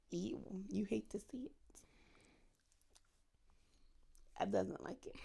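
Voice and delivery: breathy disgusted whisper. Real, not acted. Disgusted whisper after joke.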